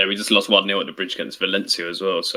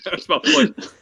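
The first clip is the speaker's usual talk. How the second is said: normal talking voice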